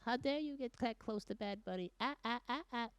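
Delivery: high, soft mock-outraged voice; outraged internet commenter. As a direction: scold in a small, high put-on voice. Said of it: "Internet's like".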